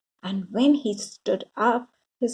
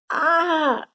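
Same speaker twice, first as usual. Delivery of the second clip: shout; 7 semitones higher than usual. A narrator's reading. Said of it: "shouted Louis".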